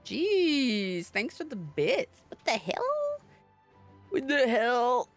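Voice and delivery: ordinary talk, nothing marked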